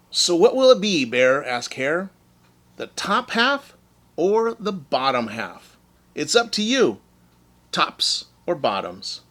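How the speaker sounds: high, sing-song sly offer; teasing Hare. Acted. Hare tempting Bear to choose, sly and sing-song. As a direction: dangle the choice like bait.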